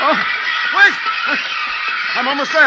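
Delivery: very high, loud gasping shout; strained shout. Acted. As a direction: force it out while fighting them off. Fighting birds near doorstep.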